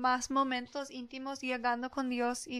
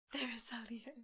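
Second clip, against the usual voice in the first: whisper; 10 dB quieter, slower than usual. Retold or imitated. Group chanting newcomer out.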